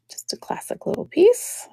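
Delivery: soft breathless whisper. Real, not acted. Repeating the brooch line to herself in a whisper. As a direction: whisper it to yourself.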